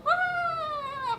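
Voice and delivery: high wailing cry of mourners; imitating a mourning wail; mourner's wail. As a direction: let out a piercing mourning wail. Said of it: "소리 질러서".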